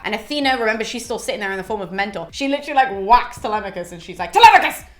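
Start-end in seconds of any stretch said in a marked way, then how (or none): 4.3-4.9 sudden high, loud reprimand; mock scolding. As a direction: call out his name like a sharp telling-off. Athena whacks and scolds Telemachus.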